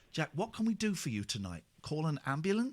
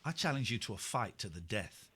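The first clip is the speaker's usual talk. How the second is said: low, quiet mock-heroic challenge; understated mock gravity; mock-solemn challenge; 6 semitones lower, 6 dB quieter than usual. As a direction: issue a duel in a lowered, softened voice. Mock duel challenge to caller.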